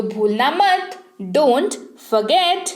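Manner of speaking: very slow dictation pace, each sound clear; slow, careful repeat. Shown as a demonstration. Teacher repeating the phrase very slowly so students can copy. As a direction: spell it out slowly for the learners. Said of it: "forget का मतलब होता है भूलना".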